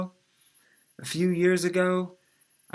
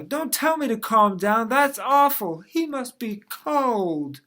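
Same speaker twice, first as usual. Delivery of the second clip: put-on high, loud huffy old-lady voice, the last phrase slowed; hammed-up grandma scolding her grandson; mad grandma; 6 semitones higher, 7 dB louder, slower than usual. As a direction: snap back, then pity him slowly. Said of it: "She's mad now".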